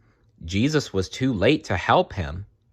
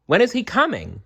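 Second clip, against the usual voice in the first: put-on high, keen child's voice; boy eager for the king; 13 semitones higher, faster than usual. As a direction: ask it like an excited kid. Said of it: "Jerem wanted to know".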